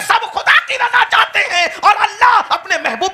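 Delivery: high, loud, laughing sermon cry; fiery preaching. Acted. Preacher rousing the gathering with soaring praise, loud. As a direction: rouse the crowd at full force.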